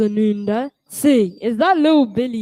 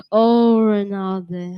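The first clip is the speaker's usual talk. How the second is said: normal talking voice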